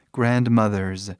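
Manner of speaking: Grandmothers said slowly, ending in a buzzing Z sound; slow plural with Z. Shown as a demonstration. Teacher to students, slow plural with Z sound. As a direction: stretch Grandmothers and buzz the final Z. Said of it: "Aí o som de Z de novo".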